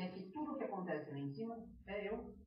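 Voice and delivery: mock-pitiful, hushed admission; imitated tearful devil. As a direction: play a crying devil, barely audible. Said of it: "chorando e chorando e chorava".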